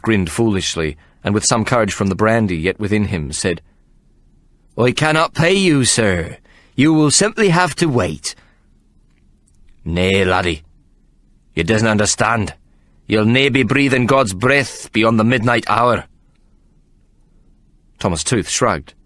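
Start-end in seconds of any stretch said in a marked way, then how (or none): 4.8-8.3 higher voice for the tipsy clerk; a brandy-brave clerk refusing to pay; drunk young clerk. A narrator's reading. Drunk clerk defies the fighter. 9.9-16.0 put-on menacing in a Scottish accent; Scottish fighter warning the clerk; Scottish threat. A narrator's reading. Figgins threatens Tooth.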